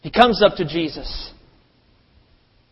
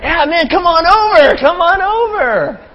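very high, loud shout; excited crowd yell, slowing at the end; eager calling; 14 semitones higher, 9 dB louder than usual. Retold or imitated. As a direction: holler them over, stretching the last call. Crowd eagerly calls the beggar over.

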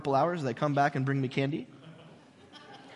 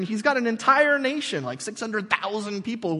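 ordinary talk, nothing marked

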